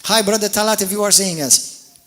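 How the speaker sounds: ordinary talk, nothing marked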